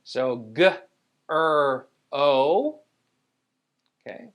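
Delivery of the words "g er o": "g, er, o" as three separate sounds; short g, pause, held "er", long "o"; sounded out. Shown as a demonstration. Teacher models grow, sounded out. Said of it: "it's saying O instead".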